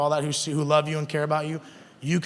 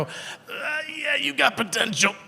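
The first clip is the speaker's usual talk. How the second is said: a breathy, unvoiced "Uh", then a higher, drawn-out, grudging "yeah"; reluctant praise; 9 semitones higher, much slower than usual. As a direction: force out a compliment you don't believe, slowly. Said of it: "It's hard to go".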